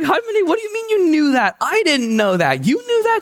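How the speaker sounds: high, loud disbelief at grandma; incredulous. Retold or imitated. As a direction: squeal it in shocked disbelief. Shocked reply to grandmother.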